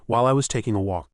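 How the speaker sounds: ordinary delivery, nothing marked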